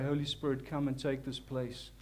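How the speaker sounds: ordinary talk, nothing marked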